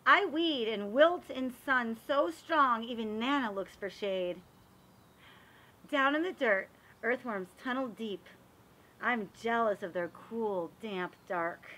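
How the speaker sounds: lively, sing-song picture-book reading; reading to children. A narrator's reading. Reading a garden picture book to children, lively storytelling. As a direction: read it like story time for little kids.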